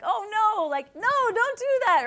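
high, loud cry of mock alarm; alarmed protest. Reader pleading with Sarah in the story, high alarmed cry. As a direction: yelp it like watching a disaster unfold. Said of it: "I'm like".

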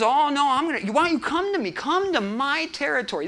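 high-pitched trash-talk retort; wrestling comeback. Acted. Challenged king dares his rival onto his territory, wrestling comeback. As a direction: fire back a cocky challenge. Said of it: "pre-fight throwdown of words".

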